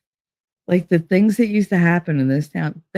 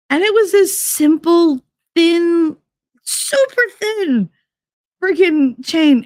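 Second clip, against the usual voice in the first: disbelief; 13 semitones higher, slower than usual. Real, not acted. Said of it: "outraged at the thin chain".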